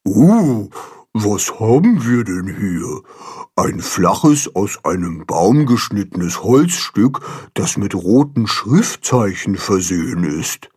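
ponderous carp character voice; Baldrian, explaining. A narrator's reading. Carp Baldrian examines the sign in the water, pontificating. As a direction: declaim it pedantically, opening on a drawn "Uh". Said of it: "erklärt Baldrian".

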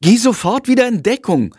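put-on boy sternly ordering the bat to hide; stern command. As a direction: boss him back into cover. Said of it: "befiehlt Jendrik streng".

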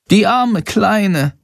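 high, drawn-out pitying voice; little bat lamenting, high and slow; bat character, pitying. A narrator's reading. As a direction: say it slowly and high, full of pity.

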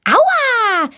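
sharp high ouch; pained cry. Acted. Girl yelping when the boy pulls her leg, high cry. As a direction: yelp as the leg is yanked. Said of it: "schreit Valentina".

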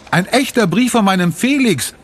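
high-pitched, delighted announcement; excited call. A narrator's reading. Sophie showing Felix's letter to Mama, excited high call. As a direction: cry out the big discovery. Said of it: "ruft sie".

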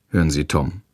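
plain reading voice

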